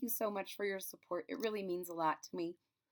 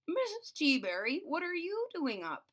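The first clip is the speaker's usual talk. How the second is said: higher, louder, surprised call to a neighbour; old neighbour surprised to see her outside; surprised question; 8 semitones higher, 5 dB louder than usual. Acted. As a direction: sound startled to find her awake. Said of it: "asked Mister Putter".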